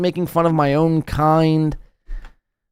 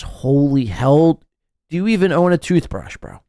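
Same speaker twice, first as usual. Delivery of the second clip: ordinary delivery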